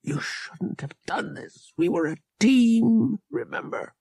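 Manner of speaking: words forced out through a raw throat; raw strained whisper. Acted. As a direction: barely get it out. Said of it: "throat so raw that he could barely force the words out".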